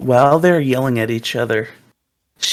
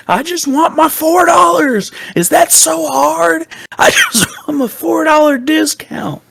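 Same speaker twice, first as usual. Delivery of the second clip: whiny customer; 6 dB louder than usual. Retold or imitated. Customer whining about discount.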